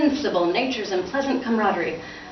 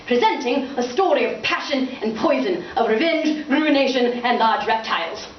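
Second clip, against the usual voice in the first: grand theatrical announcer; 6 semitones higher than usual. Acted. Father proclaiming his play bill theatrically. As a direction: sell the play like a barker.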